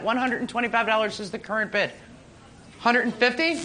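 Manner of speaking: normal talking voice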